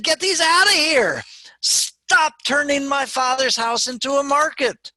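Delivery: high, loud outraged command; dramatic, full-voiced angry Jesus; angry shout. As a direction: shout them out of the temple in fury. Jesus angrily clears the temple.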